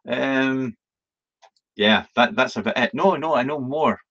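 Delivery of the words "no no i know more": ordinary talk, nothing marked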